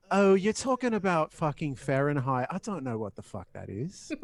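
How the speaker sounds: best-attempt Australian accent breaking into a laugh. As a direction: imitate an Australian friend catching on. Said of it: "That's my best Australian accent".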